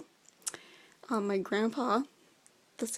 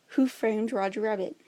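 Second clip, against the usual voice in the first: usual speaking voice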